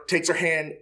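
usual speaking voice

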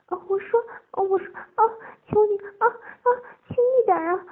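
high, loud, tearful begging; pleading cries. A narrator's reading. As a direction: beg between cries. Pleading and giving in, crying out.

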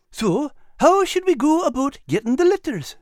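high, eager little animal voice; Al's high voice. Acted. Al urging Nigel toward the bank, high and eager. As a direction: voice Al bright and high. Al asks.

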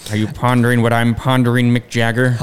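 lightly voiced low cartoon schemer; a hint of a low, scheming cartoon voice; cartoon quote. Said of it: "Sound like Pinky".

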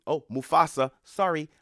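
loud, high fake-sorry dismissal; sarcastic brush-off, pushing them back out; mock apology. Mocking countries turning refugees away.

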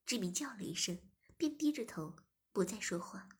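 ordinary delivery

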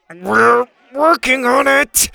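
high, loud, drawn-out effortful reply; strained yell. Acted. Bo struggling with the lock, strained and exasperated. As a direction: answer while straining at something stuck.

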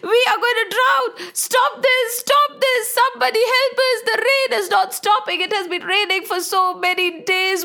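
high, frantic cries for help with a hint of crying; panicked villagers. Villagers crying out to the rain god in high panic. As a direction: plead in terror as the water rises. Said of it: "Everybody is shouting".

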